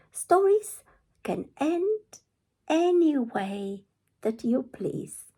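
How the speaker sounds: put-on high, loud insistent girl; Red's high voice. Red telling the wolf stories can change, high and loud. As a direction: lift it high and loud to make a point.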